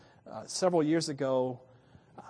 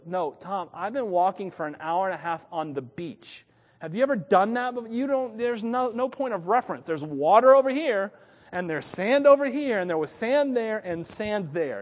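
louder and higher, pleading that he is truly lost; mock frantic protest; 6 semitones higher, 9 dB louder than usual. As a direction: replay the panic, voice climbing and loud. Retelling his lost night to Tom.

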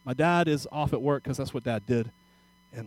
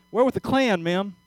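higher and louder, proudly announcing; proud Klansman; 7 semitones higher, 8 dB louder than usual. Retold or imitated. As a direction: announce it with pride. Klansman reveals himself.

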